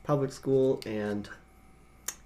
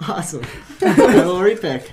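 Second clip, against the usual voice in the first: laughing; 5 semitones higher, 13 dB louder than usual. Real, not acted. Blank slip drawn, both laughing.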